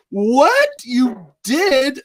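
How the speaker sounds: reading Ben's chat reply, streamer yelps in disbelief; shocked disbelief. Real, not acted. Reacting to Ben's chat message.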